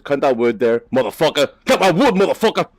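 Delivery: high, loud playful yelling; hammed-up angry shout; mock road rage. Mock yelling at another driver.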